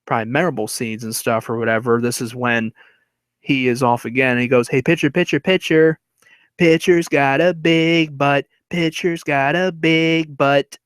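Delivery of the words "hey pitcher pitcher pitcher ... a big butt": higher heckling chant, drawn out toward the end; slow, teasing playground jeer; taunting. Retold or imitated. Henry off the base heckling the pitcher, taunting chant. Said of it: "now he is taunting the pitcher".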